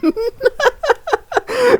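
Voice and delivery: shrill cackling royal laugh; high-pitched villain laugh. Acted. King's laugh after lines.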